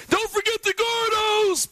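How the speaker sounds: mock scream, very high and drawn out; Emmett Golden. Host imitating an absent colleague's protest, high mock scream. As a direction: shriek it slowly like an outraged absent fan. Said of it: "Emmett Golden is screaming".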